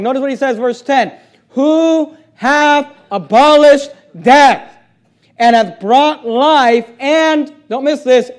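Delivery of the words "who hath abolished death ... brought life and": drawn-out emphatic reading. Acted.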